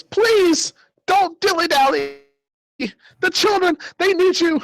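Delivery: urgent high-pitched falsetto pleading; panicked old man. Acted. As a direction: urge them on in a frantic squeak. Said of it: "in a panic state".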